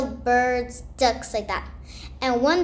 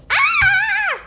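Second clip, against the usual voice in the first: high loud shocked shriek; startled scream; 7 dB louder than usual. Retold or imitated. Wife sees husband's duck wings, high loud scream. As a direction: scream in sudden fright.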